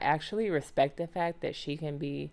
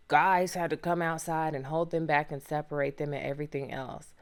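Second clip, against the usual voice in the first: normal talking voice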